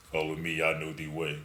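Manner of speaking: put-on deep African accent voice. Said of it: "In that African deep voice".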